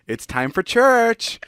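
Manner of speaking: high, slow, needling sing-song; imitating a dad who is deliberately teasing; taunting dad. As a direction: drag it out to annoy your kid. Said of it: "he'd say it in a way where he knew he was making me angry".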